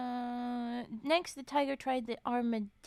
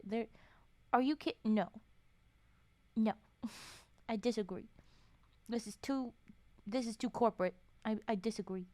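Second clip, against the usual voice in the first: ordinary talk, nothing marked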